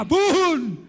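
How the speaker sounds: drawn-out booming "bum"; imitated explosion. Preacher joking about protesters' dynamite, mock explosion. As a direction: mimic a dynamite blast with your voice.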